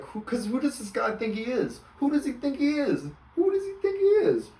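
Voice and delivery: who-does-he-think-he-is disbelief, building; incredulous outrage. Retold or imitated. Scoffing outrage at the teacher.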